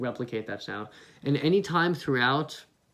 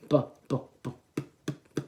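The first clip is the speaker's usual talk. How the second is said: slow bu bu, the vowel fading out; slow kick drill; faster than usual. Shown as a demonstration. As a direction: ease the vowel out beat by beat. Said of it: "Try to start with the B".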